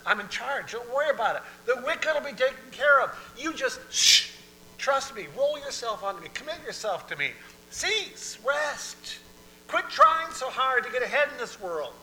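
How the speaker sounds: high, loud, emphatic authority; God in command. Acted. God telling people to stop worrying, loud insistent command. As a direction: take charge with forceful commands.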